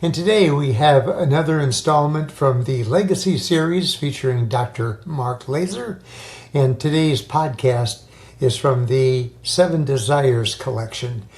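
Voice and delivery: upbeat announcer read over music; podcast intro. Acted. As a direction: introduce the show like a host.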